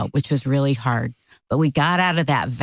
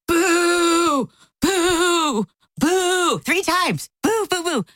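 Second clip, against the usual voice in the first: long, high-pitched boos; drawn-out high mock boo; 13 semitones higher, much slower than usual. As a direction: imitate a jeering crowd of one. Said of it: "that I boo you".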